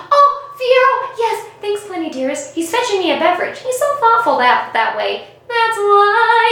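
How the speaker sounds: high chirpy mock Glinda. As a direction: play the bubbly sweet Glinda, up high. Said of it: "then she goes".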